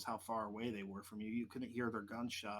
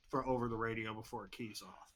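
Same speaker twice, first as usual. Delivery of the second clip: usual speaking voice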